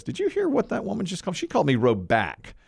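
high, quick, mock-outraged retelling; indignant quote pitched high. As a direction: imitate her huffing over the mispronounced name. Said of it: "she was really mad about it".

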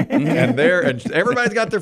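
ordinary talk

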